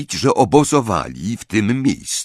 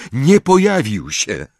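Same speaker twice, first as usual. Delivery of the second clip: slow, higher breathless admission; panting; 5 semitones higher, 4 dB louder than usual. A narrator's reading. Harry reports his failure to friends, panting. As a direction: admit it while winded. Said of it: "wydyszał Harry".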